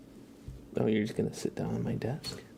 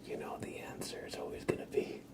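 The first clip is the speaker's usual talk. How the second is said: ASMR whisper; 7 semitones higher than usual. Acted. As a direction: whisper intimately while brushing. ASMR brushing and chat.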